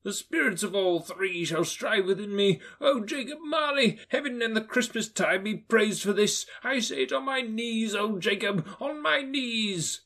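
high, fervent thanks, slowing at the end; old man overwhelmed with thankfulness; grateful Scrooge. Acted. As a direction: praise heaven on his knees.

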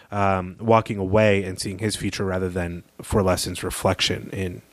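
usual speaking voice

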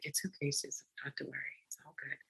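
calm her down gently like a kind council; soft reassurance. Retold or imitated. Council soothes furious Susan, hushed and reassuring.

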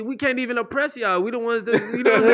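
high, fast, laughing mock complaint; mock outraged high voice. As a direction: play up a high indignant whine. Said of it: "I was just like".

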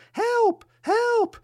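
very high tiny cries of "Help!"; small voice crying. A narrator's reading. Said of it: "a small voice crying".

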